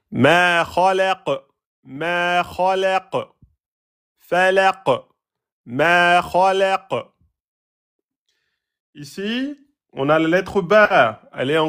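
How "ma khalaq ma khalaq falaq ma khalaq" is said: slow "ma khalaq" with a bounced final q; qalqala on final qaf. Shown as a demonstration. Teacher demonstrating qalqala on verse endings. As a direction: let the q of "khalaq" echo. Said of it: "Qaf Soukoun, Qalqala".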